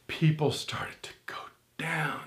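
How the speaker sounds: near-whispered, dropping away in volume; hushed. Real, not acted. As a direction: hush it as if people are sinking into trance. Describing people sinking into trance.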